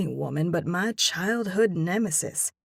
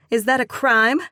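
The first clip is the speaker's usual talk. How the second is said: defiant; 5 semitones higher, 8 dB louder than usual. Acted. Defiant woman answers him.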